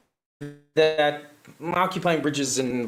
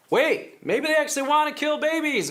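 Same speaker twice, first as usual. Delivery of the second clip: imagined voter; 12 semitones higher than usual. Retold or imitated. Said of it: "Makes people think".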